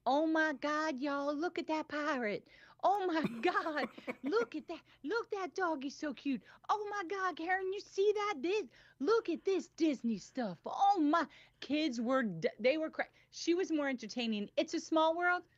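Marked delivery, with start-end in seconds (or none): 0.1-11.3 high, slow, gushing excited friend; imitating Diane gushing on the Pirates ride. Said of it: "this is all everybody heard". 14.6-15.4 high, excited gushing; imitating Diane. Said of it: "It's all she said".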